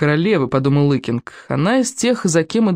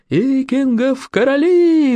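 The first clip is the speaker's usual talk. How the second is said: high, long-drawn battle cheer; roaring cheer; 8 semitones higher, much slower than usual. A narrator's reading. Crowd of young friends echoes the rallying cry, high drawn-out roar. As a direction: roar it slowly, like a whole young cohort. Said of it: "взревела целая когорта юных остолопов".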